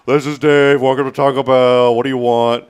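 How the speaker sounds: rude Taco Bell worker. Retold or imitated. Dave as a rude Taco Bell employee to a customer, curt and loud. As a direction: brush the customer off like a rude cashier. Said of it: "He's like".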